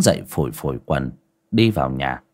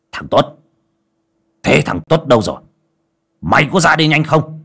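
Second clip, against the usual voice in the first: put-on old master yelling, louder and higher; hissing shout; 8 semitones higher, 5 dB louder than usual. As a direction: bark the boy's name in fury. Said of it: "lão rít lên".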